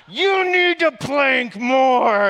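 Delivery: high, loud and slow shouted command; glaring instructor screaming at the quitters; drill sergeant shout. Retold or imitated. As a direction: hold every word out in a long furious yell. Glaring instructor yelling at recruits.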